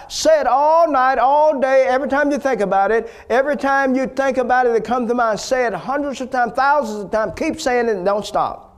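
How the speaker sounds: high, driving preacher's charge, loud at the start; urgent exhortation. Retold or imitated. Acted preacher urging the wife on.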